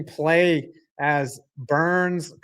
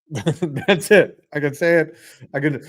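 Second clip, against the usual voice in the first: usual speaking voice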